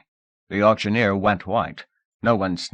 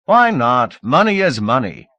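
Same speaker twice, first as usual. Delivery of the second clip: shouted; 4 semitones higher, 7 dB louder than usual. A narrator's reading. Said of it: "Someone in the crowd shouted".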